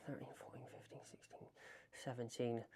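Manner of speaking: whispered count to himself; counting under breath. Real, not acted. Vlogger setting a timer, counting to himself, whispered under breath. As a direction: count very softly, almost no voice.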